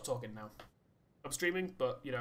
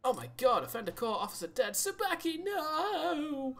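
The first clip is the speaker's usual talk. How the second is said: sudden high alarmed cry, louder; shocked outcry; 13 semitones higher, 5 dB louder, slower than usual. Real, not acted. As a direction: cry out in shock as the officer dies. Dispatch game, officer died.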